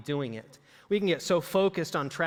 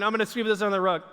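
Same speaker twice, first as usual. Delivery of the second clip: quick mock-guilty mutter, fast; sneaky aside; 3 semitones higher, much faster than usual. A stressed homemaker hiding dirt, fast mock aside. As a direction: rush it like hiding a mess. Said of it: "you're going".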